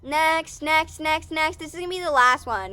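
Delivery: high, loud, rapid call; loud urging. Real, not acted. Urging on to the last question, loud and high.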